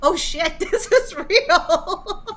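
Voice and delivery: loud, high, stretched out into laughter; laughing realization. Retold or imitated. Woman realizing the jail call is real, retold laughing. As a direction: let the retold shock collapse into laughing.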